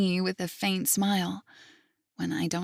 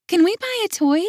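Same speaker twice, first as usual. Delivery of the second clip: put-on much higher child plea; begging little girl; 11 semitones higher, 6 dB louder than usual. Little girl begging grandpa for a toy, high. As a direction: pitch way up like a pleading child. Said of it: "she asks".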